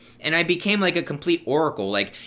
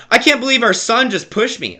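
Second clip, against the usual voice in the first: higher, louder mock complaint; whiny dad complaint; 8 semitones higher, 5 dB louder than usual. Dad complaining to mom about being pushed, mock whine. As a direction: play the wounded father.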